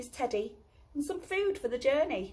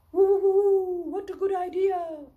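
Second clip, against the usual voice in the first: higher, louder, gleeful owl; delighted owl; 10 semitones higher, 9 dB louder than usual. Acted. Owl cheering Baby Bear's picnic idea, higher and louder.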